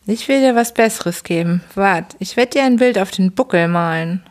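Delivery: ordinary delivery, nothing marked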